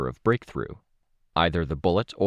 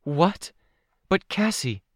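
put-on high, pleading girl voice; young girl, distressed protest; upset young girl; 12 semitones higher, slower than usual. A narrator's reading.

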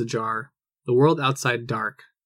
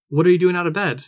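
higher and louder, carrying over the noise; shouting; 4 semitones higher, 5 dB louder, faster than usual. Acted. Mother calling to her daughter, too loud. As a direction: raise the voice without meaning to. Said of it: "You're shouting".

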